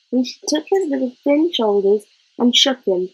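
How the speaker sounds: ordinary delivery, nothing marked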